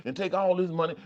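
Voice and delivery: screaming bad voice. The loud voice urging you to snatch the purse, screaming high. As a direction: egg them on like a reckless sidekick. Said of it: "The one that's screaming at you".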